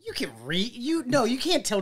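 high, stammering disbelief; incredulous objection. Retold or imitated. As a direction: re-enact arguing with an absurd claim. Acting out his incredulous argument.

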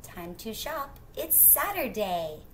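plain reading voice

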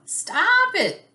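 squeaked protest, high and loud. Acted. Starfish tells the tickling Octopus off, high loud squeak. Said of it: "she squeaked".

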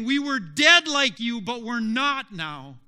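very loud, high-pitched testimony; crying out. Acted. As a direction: shout it to the lost, urgently. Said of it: "the other branches are saying".

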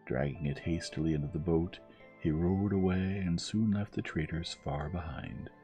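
ordinary delivery, nothing marked

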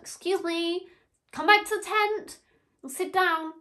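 high, loud mock summons; imitated calling out. Lady calls her back before everyone, mimicked loud high call.

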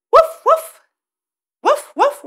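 high, loud playful woof; dog bark. Shown as a demonstration. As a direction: bark like a puppy. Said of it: "a dog says".

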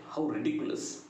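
ordinary delivery

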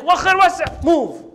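angry order. Acted. Angry Musa separates two fighting men, acted angry order. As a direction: order them apart with a touch of anger. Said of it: "Musa alayhi salam gets so angry".

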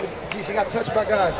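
ordinary delivery

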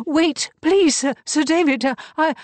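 high, loud breathless plea; desperate pleading. Acted. Beatrice pleads with the coroner, high and frantic. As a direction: beg him to wait, frantic.

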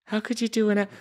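high-pitched mock disapproval; imitated offended audience. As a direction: scold like an offended listener.

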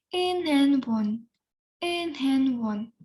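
Інгенвон slow, each syllable clear; drawn-out model of Інгенвон; Korean pronunciation. Shown as a demonstration. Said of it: "я прочитаю по декілька разів слово". («Інгенвон» повільно, по складах)

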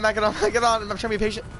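normal talking voice

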